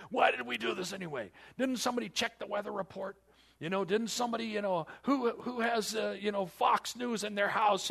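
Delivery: high, loud, frantic mock disciple; panicked disciple. Preacher voices a panicking disciple blaming his friends, high and loud. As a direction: play the frightened fisherman blaming the others.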